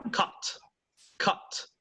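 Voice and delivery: "Cut" with a sharply released final T; "Cut" ending on an audible T; British final T. Shown as a demonstration. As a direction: say "Cut" British style, letting the T sound. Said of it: "cortar in British English".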